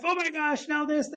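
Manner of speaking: high, breathless amazement; retold tearful excitement; overwhelmed exclaim. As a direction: gush it out to your wife, voice leaping up. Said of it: "I'm telling my wife".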